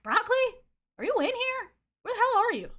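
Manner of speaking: high, loud call through a door. Retold or imitated. Friend knocks and looks for him, calling out.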